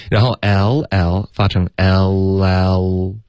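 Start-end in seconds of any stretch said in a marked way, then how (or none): 0.4-1.3 final "L L" stretched into el-lel; slow linked demo. Shown as a demonstration. Teacher demonstrating linking, "L L" slowly run together as el-lel. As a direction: hold and join "L L" very slowly. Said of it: "这个时候要连读".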